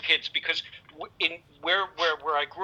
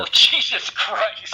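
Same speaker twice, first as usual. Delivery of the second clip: exasperated groan; 6 semitones higher, 5 dB louder, much slower than usual. Real, not acted. Reacting to crude joke.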